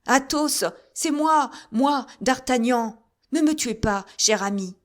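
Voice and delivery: loud, high, urgent call. A narrator's reading. Musketeer shouting up the ladder to his friend. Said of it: "en criant".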